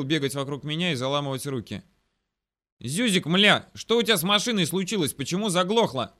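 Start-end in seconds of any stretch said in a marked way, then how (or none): 2.8-6.2 loud, raised-pitch scolding; angry yell. A narrator's reading. Officer to a hapless mechanic, angry yelling. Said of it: "заорал он на незадачливого механика".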